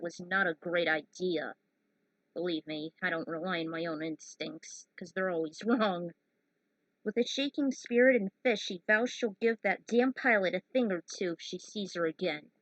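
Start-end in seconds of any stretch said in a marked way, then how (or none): none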